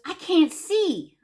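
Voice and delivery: high, loud, drawn-out complaint; slow, stretched-out retold protest; exasperated protest. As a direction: mimic a flustered husband. Husband's retold exasperated reply.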